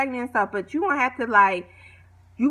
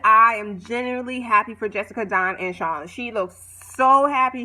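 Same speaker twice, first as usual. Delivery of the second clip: ordinary talk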